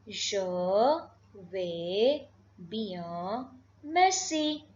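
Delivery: "Je vais bien, merci" broken by long pauses; word-by-word demonstration. Shown as a demonstration. Teacher modelling another reply, word-by-word demonstration. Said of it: "So you can say".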